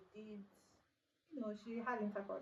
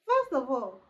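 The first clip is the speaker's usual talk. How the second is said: loud, high, indignant outburst; big, punchy "hold on" energy; emphatic objection; 4 semitones higher, 14 dB louder, slower than usual. Real, not acted. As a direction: cut in loudly to object. Restarts her objection to viewers.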